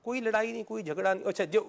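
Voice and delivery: ordinary talk, nothing marked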